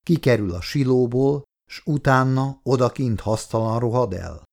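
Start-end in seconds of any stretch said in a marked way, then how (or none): none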